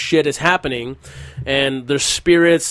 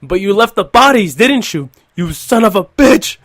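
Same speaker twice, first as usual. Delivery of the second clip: higher, louder angry shouting at the landowner; reenacted furious yell; 7 semitones higher, 7 dB louder than usual. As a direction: yell it like the enraged dad. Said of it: "and he says".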